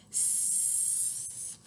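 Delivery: long, soft sibilant hiss; snake hiss. Shown as a demonstration. Reader showing children how a snake sounds, hissing. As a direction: let the S stream out like a snake. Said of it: "hiss like a snake".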